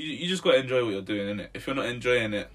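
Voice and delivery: ordinary talk, nothing marked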